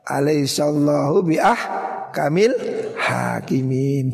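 slow chanted verse; Quran recitation. Acted. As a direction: recite slowly.

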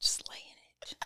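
hushed, whispered teasing; playful whisper. Pretend. Host carries on the joke with co-host, playful whisper.

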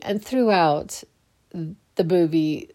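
usual speaking voice

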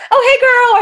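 imitating a coworker's high chirpy greeting, laughing. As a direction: parody an overfamiliar coworker, bright and high. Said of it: "they'll be like".

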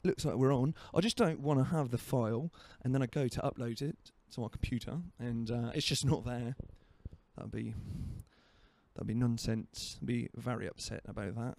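put-on British accent, understated delivery; casual mock-British accent. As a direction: play at being British, letting the accent wobble. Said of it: "that accent started, that started going away from British".

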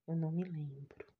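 barely a whisper to herself; soft whispered inner voice; whispered thought. Acted. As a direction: whisper it like a private confession. Clara's inner thought, hushed.

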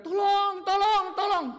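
high cry for help, tolong; calling for help. Pretend. Trapped in a dark well, crying up for help, pitched high. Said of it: "kita teriak".

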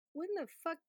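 high-pitched, incredulous swearing; baffled exasperation. Retold or imitated. Viewer lost in the movie's era, baffled and exasperated. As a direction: replay your utter confusion, voice jumping up. Retold bafflement at period setting.

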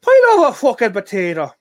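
high, loud mock Irish accent; bad Irish accent. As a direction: belt it out in a hammed-up Irish accent. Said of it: "Irish accent".

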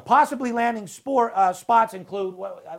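losing the thread mid-sentence; stumbling. Real, not acted. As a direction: trip on a word, then fumble for the rest. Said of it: "Now you're stuttering".

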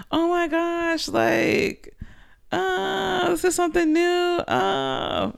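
high, soft giddy squeal; giddy excitement. Retold or imitated. Telling viewers about new plans, high giddy squeal. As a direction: squeal it like butterflies in your stomach. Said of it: "But it's like".

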